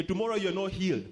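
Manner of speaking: ordinary talk, nothing marked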